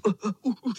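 high, short gasps of effort; straining grunts. A narrator's reading. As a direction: grunt under a load too heavy to hold. Bottom boy straining under weight. (namáhavé hekání)

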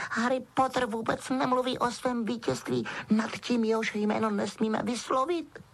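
high-pitched, respectful elf; Dobby, reverent. A narrator's reading. Elf praising his hero's modesty, reverent. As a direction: squeak it in pure admiration. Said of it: "řekl Dobby úctivě".

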